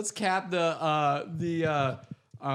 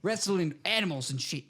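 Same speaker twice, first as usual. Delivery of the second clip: mock Australian; 5 semitones higher than usual. Continues mock Aussie bit.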